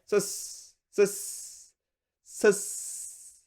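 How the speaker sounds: "そうっす" breathy and clipped, the small っ held; casual soussu. Shown as a demonstration. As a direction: murmur "そうっす" like a laid-back junior. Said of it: "Someone might say".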